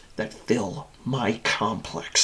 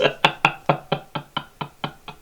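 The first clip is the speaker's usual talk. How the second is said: long stuttering laugh trailing away; breathless cruel cackle; villain chuckle; 4 dB quieter than usual. Acted. Machine laughs at tormented captive, trailing chuckle.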